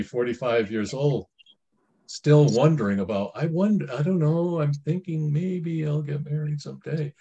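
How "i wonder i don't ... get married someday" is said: high, dreamy voice of indecision; mock wishy-washy dithering. Forty-year-old still wondering about marriage, mock dithering. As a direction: imitate someone endlessly musing, pitched up. Imitating undecided unmarried older people.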